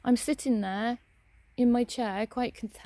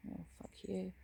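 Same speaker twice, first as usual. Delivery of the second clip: muttered under breath; 15 dB quieter than usual. Real, not acted. Answering the woman's sarcastic apology, muttered. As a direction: mutter it to yourself.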